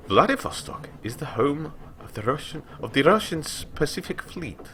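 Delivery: usual speaking voice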